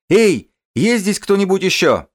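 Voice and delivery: high loud desperate yell. Acted. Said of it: "Мужик орёт".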